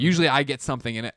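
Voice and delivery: usual speaking voice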